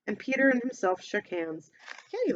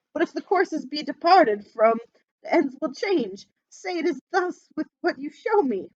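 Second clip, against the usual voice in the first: put-on louder, pleading old miser; anxious Scrooge; 7 semitones higher, 5 dB louder than usual. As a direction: beg the spirit nervously.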